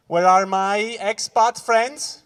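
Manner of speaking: normal talking voice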